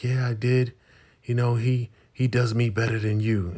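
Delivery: high, loud mock confession; taunting wife. Wife taunting Brian about Malcolm, high mock defiance. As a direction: throw it at him in a high, loud voice.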